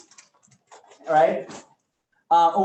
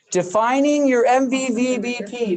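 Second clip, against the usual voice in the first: grand announcement; 9 semitones higher, 8 dB louder than usual. Pretend. Announcing the next topic dramatically.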